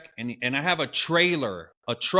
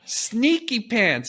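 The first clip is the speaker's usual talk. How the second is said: teasing; 9 semitones higher, much slower than usual. Real, not acted. Mock teasing remark.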